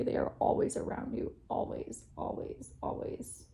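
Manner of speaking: soft tender whisper, fading away; an intimate whisper that trails off. Real, not acted. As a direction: breathe it out gently to comfort. Tender reassurance about lost ones.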